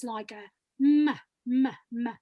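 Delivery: slow humming m on closed lips; lip m sound. Shown as a demonstration. Coach shows the class, a slow pressed m. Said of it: "sounds that are made with the lips".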